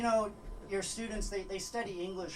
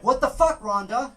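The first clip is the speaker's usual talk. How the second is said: shout; 4 semitones higher, 12 dB louder than usual. Retold or imitated. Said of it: "I was like".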